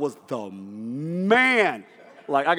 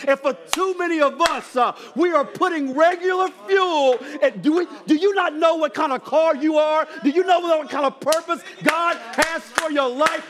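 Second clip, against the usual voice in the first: preaching at full force; 10 semitones higher than usual. Acted. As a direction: drive it home to the church. Preaching application.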